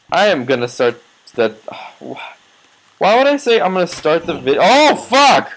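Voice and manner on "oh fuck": high, loud startled "oh fuck!"; jolted by sudden danger; startled curse. Real, not acted. Gamer suddenly hit in game, startled loud curse.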